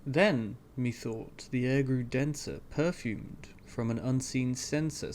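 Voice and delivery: usual speaking voice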